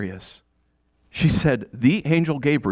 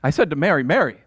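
usual speaking voice

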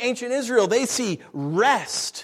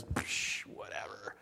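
whispered, offhand brush-off; dismissive scoff; 11 semitones lower, 16 dB quieter, much slower than usual. Acted. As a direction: wave it away under the breath. Imagined dismissive reaction.

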